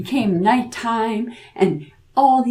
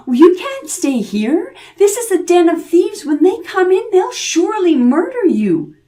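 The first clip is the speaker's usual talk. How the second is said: put-on much higher, louder, alarmed old woman; frightened old woman; 9 semitones higher, 5 dB louder than usual. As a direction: warn him in a panic. Old woman warns the boy.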